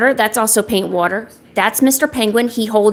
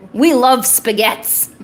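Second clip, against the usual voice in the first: slow, lightly hammed-up mobster; put-on mobster relish; gangster voice; 3 semitones higher, slower than usual. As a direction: draw it out like a pretend gangster. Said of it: "lines to say in my gangster voice".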